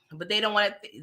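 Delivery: normal talking voice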